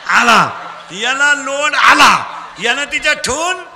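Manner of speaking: loud, projected rallying of a crowd; call-and-response preaching. Real, not acted. Preacher drilling children for loud answers, call-and-response preaching. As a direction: throw each phrase out for the crowd to finish.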